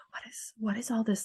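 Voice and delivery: breathy, hushed wondering; bewildered whisper. Retold or imitated. Newly awakened woman asking herself what this is, whispered. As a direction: whisper it like a secret you can't make sense of. Her confused thoughts about spirituality.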